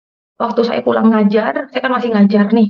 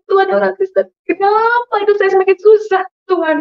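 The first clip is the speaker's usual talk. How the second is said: much higher, wailing plea to God; retold crying prayer; 10 semitones higher than usual. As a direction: re-enact a tearful lament, pitched high. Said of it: "Saya nangis ke belakang kontrakan". (ratapan tangis yang ditirukan)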